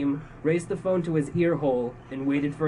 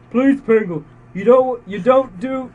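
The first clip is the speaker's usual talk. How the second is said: high, loud, slow pleading; desperate begging; 8 semitones higher, 11 dB louder than usual. Acted. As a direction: beg with a gun pointed at you. Colonel begs at gunpoint.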